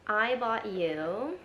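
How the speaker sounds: normal talking voice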